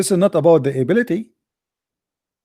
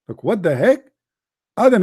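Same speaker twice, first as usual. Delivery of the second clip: ordinary talk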